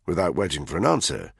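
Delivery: ordinary delivery, nothing marked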